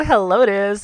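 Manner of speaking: ordinary delivery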